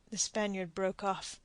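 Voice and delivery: usual speaking voice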